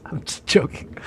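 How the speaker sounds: hushed take-back of a joke; whispered. Real, not acted. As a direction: whisper I'm just joking under the breath. Backing off a drug joke.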